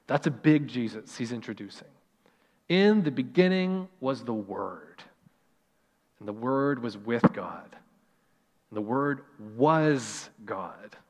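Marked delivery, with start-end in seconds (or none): 2.7-11.0 reverent reading. Acted. Reading scripture slowly, reverently.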